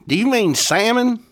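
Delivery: high-pitched query, salmon as SAM-un; salmon, silent L. Acted. Co-host correcting the Italian speaker, puzzled and high. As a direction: ask with "salmon" missing its l.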